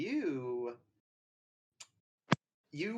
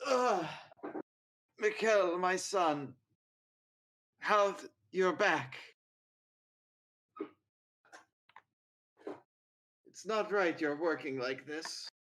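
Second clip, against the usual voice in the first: lightly voiced frail old father; a hint of a bedridden old man; 5 semitones higher than usual. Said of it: "he's this very old man".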